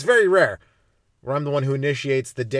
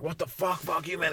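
usual speaking voice

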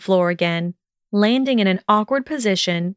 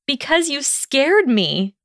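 higher, drawn-out indignant comeback; insistent retort; 7 semitones higher, slower than usual. A narrator's reading. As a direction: insist on it, pitch lifted. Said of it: "she repeated".

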